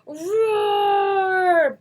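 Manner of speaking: high loud angry bear roar. Acted. Bruce loses patience.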